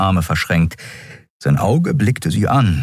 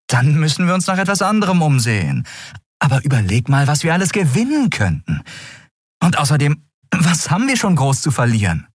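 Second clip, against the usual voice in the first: high, quick, undeterred character voice; high-pitched, upbeat persuasion; persuasive Endriel; 11 semitones higher, faster than usual. Acted. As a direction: argue on briskly, pitched high.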